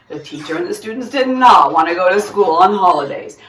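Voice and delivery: ordinary delivery, nothing marked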